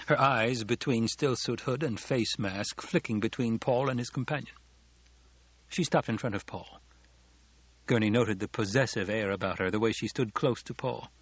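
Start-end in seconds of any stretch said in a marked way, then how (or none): none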